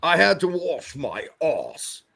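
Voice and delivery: put-on a light, slow Sean Connery accent; lightly voiced Scottish Connery; slow hint of a Connery drawl; Sean Connery impression. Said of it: "That's what he would say".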